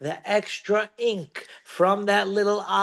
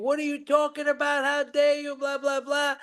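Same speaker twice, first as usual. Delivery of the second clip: mock screaming; 9 semitones higher than usual. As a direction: rattle off a mock protest up high. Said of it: "he starts screaming".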